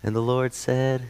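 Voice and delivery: put-on soft, slow talk to a baby; lightly voiced kid-style Bible reading; a hushed hint of kiddie voice; little kid voice. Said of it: "this little kid voice".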